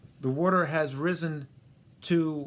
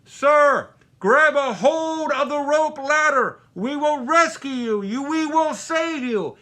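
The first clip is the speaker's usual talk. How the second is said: much higher, much louder, slow shouted orders; megaphone yell; 12 semitones higher, 10 dB louder than usual. Retold or imitated. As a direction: bellow down from a helicopter, slow and high. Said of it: "yells out with the megaphone".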